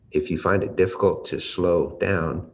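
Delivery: usual speaking voice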